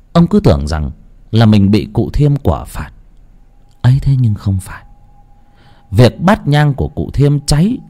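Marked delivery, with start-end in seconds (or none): none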